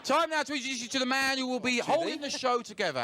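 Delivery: high announcer build to a long shout; rousing stage introduction. Real, not acted. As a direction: bring the host on, stretching the name out loud. Warm-up man introduces the show's host.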